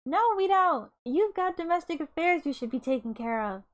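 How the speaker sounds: high, loud indignant shout. Acted. Steph shouting at the lazy king about his duties, loud and high. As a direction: yell it to cut him off. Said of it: "Steph shouted".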